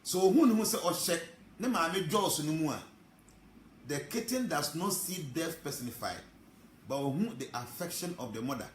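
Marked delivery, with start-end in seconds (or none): none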